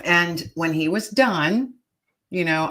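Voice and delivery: ordinary talk, nothing marked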